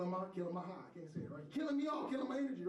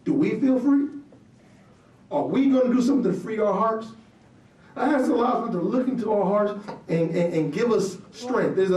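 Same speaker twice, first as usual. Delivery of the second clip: loud, ringing sermon delivery; fiery preacher's voice; preaching at full force; 29 dB louder than usual. Acted. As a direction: preach it out to the whole room.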